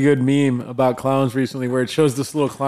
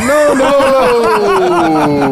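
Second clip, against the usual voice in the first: long, high, laughing wail; drawn-out retold protest; 8 semitones higher, 5 dB louder, much slower than usual. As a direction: stretch each no out in mock protest. Retold protest at unwanted yard work.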